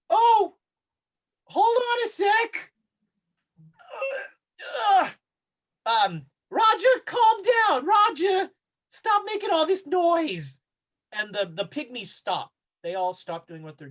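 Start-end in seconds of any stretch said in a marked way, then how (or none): 0.1-10.6 high, loud hollered scolding; Hypatia. Acted. Game master plays wounded Hypatia yelling at Roger, high frantic shouting. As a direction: shout it way up high, exasperated at the racket. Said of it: "you hear Hypatia going like".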